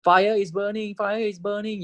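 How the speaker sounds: calling out fire as an example; lightly acted alarm call; mock alarm. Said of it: "if you say".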